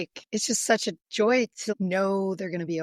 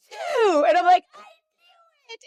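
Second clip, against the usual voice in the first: excited win; 14 semitones higher, 8 dB louder, slower than usual. Retold or imitated. Kid calls her winning number.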